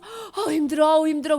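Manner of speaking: mock exhausted moan; tired groan. Workers after building, mock tired. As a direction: sigh it like worn out.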